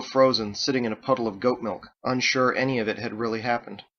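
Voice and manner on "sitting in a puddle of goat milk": ordinary delivery, nothing marked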